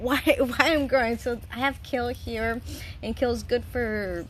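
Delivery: ordinary delivery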